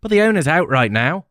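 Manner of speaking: put-on young woman, light English accent. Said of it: "She had an English accent".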